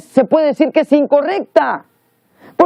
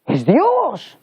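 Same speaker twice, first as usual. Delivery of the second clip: awed discovery, slowly swelling on Dios; acted amazed realization. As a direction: act out a stunned realization. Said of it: "y entonces dijo".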